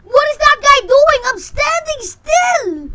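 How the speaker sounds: high, loud outraged yell; outraged shout. Real, not acted. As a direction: yell it in disbelief. Outraged at other player.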